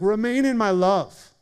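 voicing God's invitation. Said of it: "He's like".

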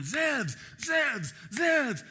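chanting the name loud and high; three rising crowd shouts of the name; crowd yelling. Retold or imitated. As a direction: call the name out like a rowdy crowd. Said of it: "they started yelling".

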